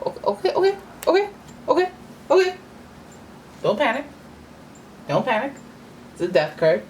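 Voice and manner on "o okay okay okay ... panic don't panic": high, startled panicky okays; real jolt of fright, talk yourself down; panicked. Real, not acted. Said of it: "So now I'm back to being re-scared".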